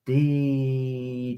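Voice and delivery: ordinary talk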